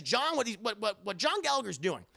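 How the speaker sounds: usual speaking voice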